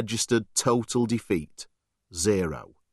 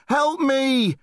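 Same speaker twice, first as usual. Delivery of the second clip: desperate cry for help; 14 semitones higher than usual. Acted. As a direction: call out for help in terror. Boy fleeing the beast.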